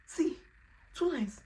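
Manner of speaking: high, loud proud exclaim; excited showing. Retold or imitated. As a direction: show it off thrilled.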